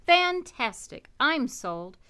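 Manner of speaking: usual speaking voice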